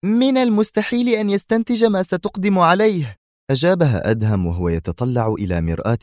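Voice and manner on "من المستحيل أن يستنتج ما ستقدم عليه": much higher, tense woman's voice; high-pitched, on-edge cry; nervous exclamation. A narrator's reading. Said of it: "هتفت منى في عصبية".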